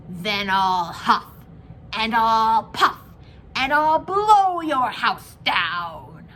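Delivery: slow, loud menacing wolf, building to a shout; big bad wolf. A narrator's reading. Wolf threatening the little pig, slow and loud. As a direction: bellow each threat slowly. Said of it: "Then the wolf showed his teeth and said".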